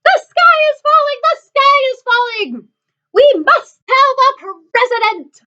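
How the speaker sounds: very high, stretched-out squawked alarm; yelling barnyard birds. Acted. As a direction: squawk it like frantic poultry. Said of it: "and yelled".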